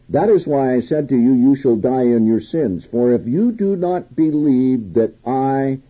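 reading the Bible passage aloud with weight; scripture reading. Acted. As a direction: proclaim the verse to the congregation.

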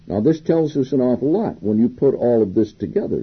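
usual speaking voice

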